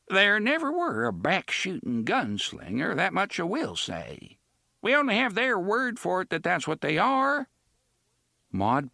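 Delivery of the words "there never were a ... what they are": put-on high, thin old woman warning; a wary old woman speaking up. Old woman warns gunslingers.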